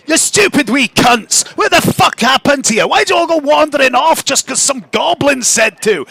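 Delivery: high-pitched angry little mushroom; tiny creature's indignant shouted telling-off; Fergus. Acted. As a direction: scold them in a high, sweary character voice. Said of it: "Moxie, you hear".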